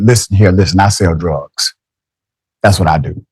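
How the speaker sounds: lightly imitated man, pitched well down; quoted neighbor. Host retelling a man's admission to neighbors, low mock quote. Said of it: "went to the neighbor and said".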